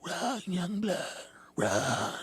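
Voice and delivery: strained, hushed warning from afar; drawn-out secret plea to flee; eerie old-man stage whisper; hunched old-timer. Acted. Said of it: "hunched up like fucking Hunchback of Notre Dame".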